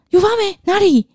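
loud, high shocked yelp. Retold or imitated. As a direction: jolt up in alarm. Shocked at mould claim.

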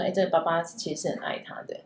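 soft whispered inner thought. Retold or imitated. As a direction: breathe it like a secret realization. Daughter's imagined inner thought.

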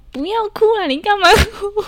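high, loud, laughing mock scold; scolding brother. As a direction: tell him off through your giggles. Scolding her crying brother. (边笑边凶哥哥别哭)

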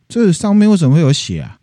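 a mock angry husband; suspicious demand. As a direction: confront her about the blood. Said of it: "蓝胡子说".

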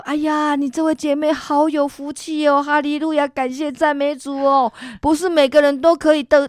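high, bright-pitched cheerful congratulating; joyful praise. Retold or imitated. Church members clapping and congratulating her, gushing. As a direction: gush over her like church aunties.

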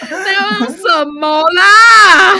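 loud, slow laughing protest; laughing shout. Real, not acted. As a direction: yell it while cracking up. Laughing mock outrage.

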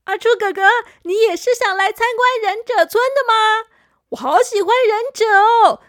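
put-on high, loud bubbly child voice; happy. Little boy happily telling friend he loves ninjas, high and loud. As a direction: gush with delight. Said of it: "小扑很开心地说".

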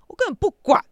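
high, loud retold snap of defiance; furious retort, pitched high and loud; angry outburst. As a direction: fling it back at the roommate. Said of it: "我当下就很气哦".

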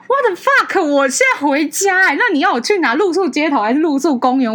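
high, loud indignant comeback; exasperated retort. Retold or imitated. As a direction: fire back in disbelief. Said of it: "然后我就想说". (高声愤慨地反问)

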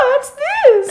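put-on high squeaky little penguin girl; playful cartoon penguin girl; cute girl penguin voice. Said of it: "She'd be like".

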